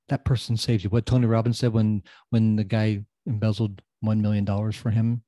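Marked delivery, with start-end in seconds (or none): none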